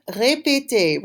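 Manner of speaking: ordinary talk, nothing marked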